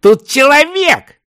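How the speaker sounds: very high, loud, drawn-out exclamation; astonished cry. A narrator's reading. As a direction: announce an unbelievable find. Startled report of the find. (изумлённый крик)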